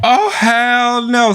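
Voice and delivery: slow, drawn-out high oh hell no; disbelief. Real, not acted. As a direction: refuse it outright. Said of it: "reacting to video".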